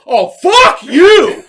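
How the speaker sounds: very loud, high, drawn-out shout; full road-rage bellow. Retold or imitated. Driver topping the other man's insult, furious slow bellow. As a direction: bellow it, stretching each word.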